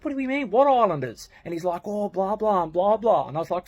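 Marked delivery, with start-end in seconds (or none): 0.0-1.3 high, loud disbelief; raised-pitch, incredulous comeback; incredulous questioning. Retold or imitated. Acted disbelieving reply to excuse. 1.8-3.3 higher-pitched dismissive blah-blah; mock the rambling, pitched up; mocking excuse. Mimics friend's long excuse.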